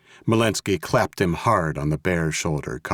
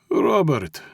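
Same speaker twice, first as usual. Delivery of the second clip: higher-pitched Russian boss calling a name; Russian mob boss; 9 semitones higher, 4 dB louder than usual. A narrator's reading. Mob boss rousing his slumped captive, coaxing.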